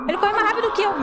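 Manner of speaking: very high, loud shrieked complaint; panicked shriek. Real, not acted. Being chased, repeats line.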